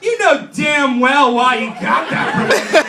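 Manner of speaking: high, loud catty outrage; mock indignation. Jealous coworker about Mike's promotion, mock outrage.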